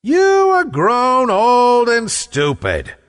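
high, slow, drawn-out giantess scolding; loud scolding cry. A narrator's reading. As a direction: cry it out high at your foolish husband. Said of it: "cried the lady in her loud tones".

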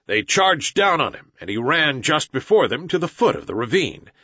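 usual speaking voice

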